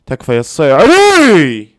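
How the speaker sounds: plea exploding into a long high scream; pleading yell. Real, not acted. Fan begging Al-Saiari in the shootout, pleading yell. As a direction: stretch the name into a loud high yell. Pleads with keeper during penalties.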